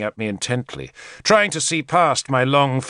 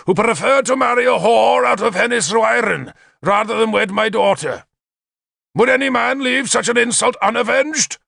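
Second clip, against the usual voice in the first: high, indignant rallying speech; addressing the hall; 10 semitones higher than usual. A narrator's reading. Insulted father rousing his hall, high declamation. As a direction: project outrage to a whole crowd. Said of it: "but to the whole hall".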